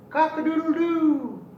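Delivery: high, loud crowing rooster call. Acted. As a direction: crow it out like a proud little rooster. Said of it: "he cried".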